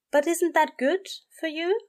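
high-pitched, gentle questioning angel; mild-mannered angel. A narrator's reading. Aziraphale questions his complaining friend, mildly puzzled. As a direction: lift the voice well up, well-meaning.

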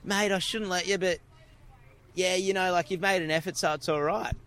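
lightly voiced clerk giving in; bottle shop guy. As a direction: play the relenting shop clerk. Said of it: "the guy's like".

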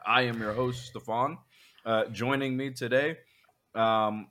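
normal talking voice

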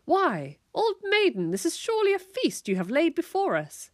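high, hammed-up courtly knight; play-acting knight praising a feast; grand knightly declaration. A narrator's reading. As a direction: declare it with mock-medieval flourish. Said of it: "Walt declared".

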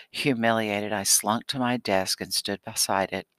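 usual speaking voice

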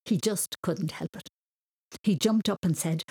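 ordinary delivery

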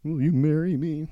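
high, hammed-up weepy proposal; mock tearful plea. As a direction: pretend to propose through tears. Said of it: "I'll be crying the entire time".

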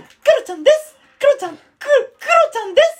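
squeaky falsetto catchphrase; very high, childlike put-on voice; Kuro-chan. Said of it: "常にクロちゃんで行く". (甲高い裏声のモノマネ)

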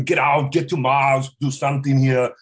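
Schwarzenegger impression. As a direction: push each word out like Arnold. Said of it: "switch it around and do".

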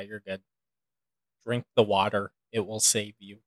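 ordinary delivery

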